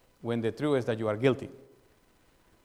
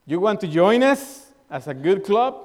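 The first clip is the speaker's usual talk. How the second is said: higher and louder mock challenge; old-style church member quizzing a newcomer, mock stern; demanding church member; 5 semitones higher, 7 dB louder than usual. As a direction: grill the newcomer like a strict club. Voicing old demanding church attitude.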